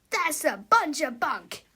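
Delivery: loud, slow, scornful shout; angry yell. A narrator's reading. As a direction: bark it back in disbelief. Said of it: "yelled Melvin".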